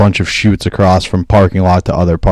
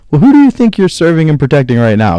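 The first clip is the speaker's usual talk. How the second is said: mock challenge to a cop, pitch jumping up on the question; giving attitude; 8 semitones higher than usual. Man imagining questioning the cops after his crash, giving attitude. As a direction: act out sassing a police officer. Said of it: "when you're giving him attitude".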